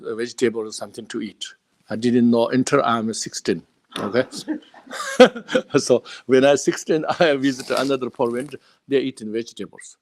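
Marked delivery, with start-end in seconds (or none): 4.9-5.7 a sudden high-pitched burst of laughter. Real, not acted. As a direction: laugh out loud at the memory. Laughs recalling own childhood.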